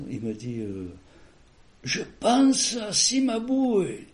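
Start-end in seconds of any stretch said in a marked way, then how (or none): 1.8-4.1 dying man's faint words. Retold or imitated. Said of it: "il avait la voix qui avait baissé".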